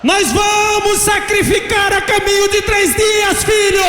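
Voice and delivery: high, ringing battle cry; impassioned shout. Retold or imitated. Mother leaping up to claim her son from Satan, shouting. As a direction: hurl it out as a fierce declaration.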